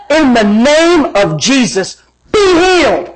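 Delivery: drawn-out, building to a high ringing command; preacher's command, rising at the end; commanding healing proclamation. Retold or imitated. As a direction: proclaim it slowly like a faith healer. Acts out his healing command.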